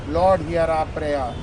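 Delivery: ordinary talk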